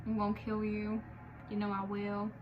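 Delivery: lightly voiced mock threat; a hint of a villain promising revenge. Imitating a vengeful singer, lightly menacing. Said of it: "she was coming back".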